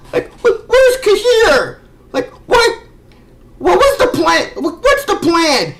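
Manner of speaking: where's Cahir, how can this be; baffled disbelief. Real, not acted. Baffled by the plot hole.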